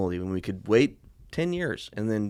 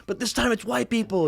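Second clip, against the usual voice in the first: high mock voice; 13 semitones higher than usual. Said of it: "he's like".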